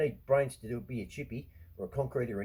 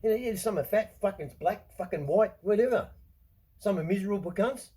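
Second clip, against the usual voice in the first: higher and louder, worked up; heated listing; 9 semitones higher, 6 dB louder than usual. Real, not acted. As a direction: rattle them off fired up. Heated list of differences.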